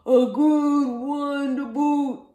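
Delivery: very loud, slow pirate chorus; hammed-up drawn-out crew shout; pirate crew agreeing. A narrator's reading. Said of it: "the others agreed".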